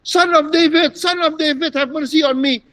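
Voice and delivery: imitated shouting plea. Bartimaeus crying out to Jesus, high imitated shout. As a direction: call out high for mercy. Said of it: "kan juga gitu teriak-teriak".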